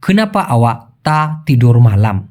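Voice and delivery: very slow, each word separate; Malay sentence said very slowly; slow Malay demo. Shown as a demonstration. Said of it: "বাক্যটা এরকম হয়ে যায়".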